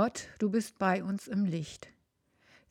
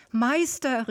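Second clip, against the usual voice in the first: a joyful call, louder and higher; 5 semitones higher, 6 dB louder than usual. Acted. Mary Magdalene calls out to her teacher with joy. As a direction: call out to Jesus, running to him. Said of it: "rief ich".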